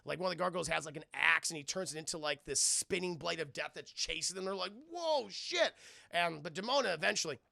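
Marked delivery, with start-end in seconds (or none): 4.9-5.7 high startled yelp; acted-out shock; mock alarm. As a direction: react with a high, startled whoa. Gargoyles reacting to spinning blade.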